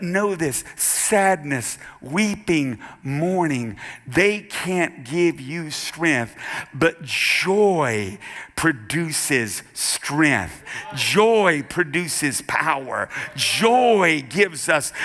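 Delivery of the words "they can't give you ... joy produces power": joy hit hard and slow; slow emphatic. Real, not acted. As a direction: stretch each word slowly.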